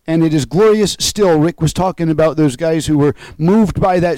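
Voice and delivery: loud, soaring preacher's proclamation; full-force preaching. Acted. Preacher proclaiming the risen Christ to his congregation, full force. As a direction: lift the congregation with every line.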